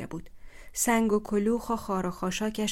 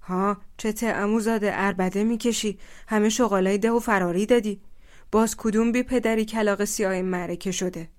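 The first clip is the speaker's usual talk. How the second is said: stern rebuke; 6 dB louder than usual. Acted. Elder confronts shouting cousin.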